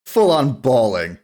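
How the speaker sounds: usual speaking voice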